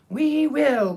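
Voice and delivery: high, loud squeaky reply; all the mice answering at once; shrill mouse chorus. Acted. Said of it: "in a shrill chorus".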